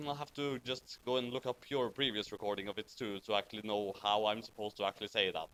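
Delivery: ordinary talk, nothing marked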